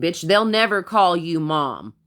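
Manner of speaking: really letting the anger out; worked up. Real, not acted. Ranting about the ex's new girlfriend, getting worked up. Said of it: "I'm getting myself worked up".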